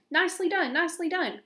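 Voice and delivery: high, excited goose cheer; loud, delighted goose; cheering cry. A narrator's reading. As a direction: cry out praise, pitched well up. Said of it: "cried the goose".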